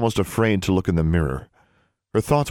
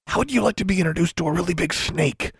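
threatening; 12 semitones higher than usual. A narrator's reading. Girl threatens the noisy creature she carries, menacing. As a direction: threaten it in a high, strained-up voice.

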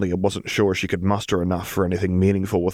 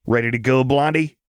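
Jinx voice; 5 semitones higher, 3 dB louder than usual. A narrator's reading.

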